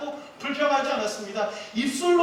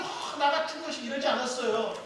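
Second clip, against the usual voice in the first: ordinary talk, nothing marked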